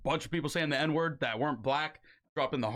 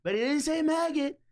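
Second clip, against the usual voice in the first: Trump impression; 7 semitones higher than usual. Host doing Trump shouting to the folks about the slur. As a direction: bellow it to the crowd, hammed up. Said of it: "my impression's pretty good".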